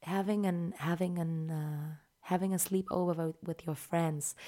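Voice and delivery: soft, hesitant English video intro; ASMR roleplay. Retold or imitated. ASMR creator introducing a sleepover roleplay, soft imitation. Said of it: "manchmal so Roleplay".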